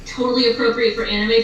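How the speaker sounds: ordinary talk, nothing marked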